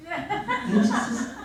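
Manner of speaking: plain reading voice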